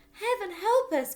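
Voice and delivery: very high, loud pleading wail; mother clinging in terror; terrified cry. Acted. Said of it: "cried the mother".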